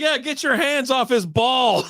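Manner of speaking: high, slow shouted retort; mock shout. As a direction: call back across the fairway, teasing. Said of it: "I was like".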